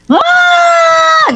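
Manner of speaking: high-pitched shriek for mum; child's scream. Retold or imitated. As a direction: imitate a startled child screaming for mother. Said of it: "dia teriak".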